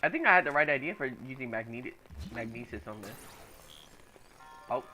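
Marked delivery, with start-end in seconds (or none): none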